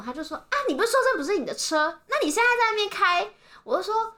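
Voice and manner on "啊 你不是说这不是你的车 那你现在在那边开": high-pitched imitation of an accusing old man; head-on accusation. Imitating the husband confronting her at the window, high and accusing. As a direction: fire the challenge straight at her. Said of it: "他就直接劈头".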